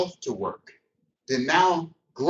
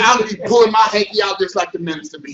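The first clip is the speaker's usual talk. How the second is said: ordinary talk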